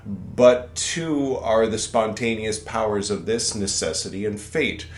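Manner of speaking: put-on deliberate formal reading of the old text; solemn text reading. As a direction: recite it like scripture. Reads scripture aloud.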